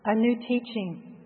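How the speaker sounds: usual speaking voice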